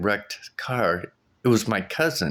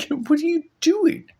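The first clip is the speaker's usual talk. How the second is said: laughing disbelief; 5 dB louder than usual. Retold or imitated. Cousin taking her stuff.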